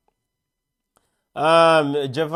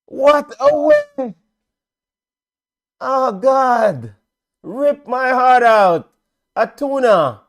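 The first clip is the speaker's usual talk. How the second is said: loud, slow, anguished lament; heartbroken cry; 7 semitones higher, 6 dB louder, slower than usual. Real, not acted. Commentator mourning the late goal, loud and slow. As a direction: wail as if your heart was ripped out.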